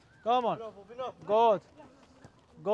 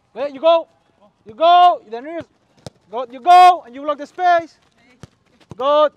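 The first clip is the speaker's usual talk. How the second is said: loud, raised shout across the pitch; shouted coaching call; 4 semitones higher, 15 dB louder than usual. Real, not acted. Coach directing a player to block the space, shouting. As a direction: call it out hard and high to players. Coach calling out during a drill.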